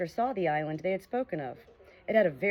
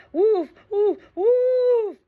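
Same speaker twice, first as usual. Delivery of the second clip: high, loud woofs like a dreaming dog; loud dog barking; 10 dB louder, much slower than usual. Acted. Jip barks at pirates in his sleep, loud woofs. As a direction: bark it out like a big dog asleep. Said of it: "he barked loud".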